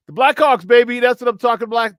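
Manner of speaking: loud, fired-up victory shout; excited cheer. Real, not acted. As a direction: celebrate the win out loud. Cheering a team win.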